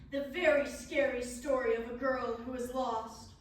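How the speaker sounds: loud, grand scary-story announcement; dramatic storyteller. Acted. Performer introduces tale of a lost girl, loudly dramatic. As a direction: proclaim it like a spooky tale's title.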